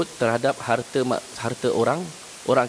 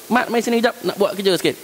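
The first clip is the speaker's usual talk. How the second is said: higher call across the room to the worker; boss calling out; 8 semitones higher than usual. Retold or imitated. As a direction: call the employee over, pitched up. Chef ruft Angestellten.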